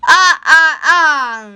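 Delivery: rising theatrical vampire laugh; Count's counting laugh. A narrator's reading. The Count to Elmo, a theatrical ah-ah-ah laugh.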